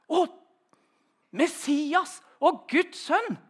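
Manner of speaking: a mild cry of wonder at meeting him. Retold or imitated. Bartholomew recognising Jesus, calling out in awe. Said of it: "roper han ut".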